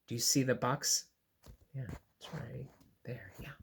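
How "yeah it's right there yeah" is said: whisper. Real, not acted. Whispered aside.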